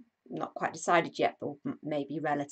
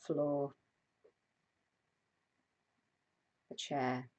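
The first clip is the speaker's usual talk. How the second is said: guided meditation; 3 dB louder than usual. Acted. Host guiding a live stream body scan, calm meditation voice. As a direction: ease listeners into stillness, unhurried.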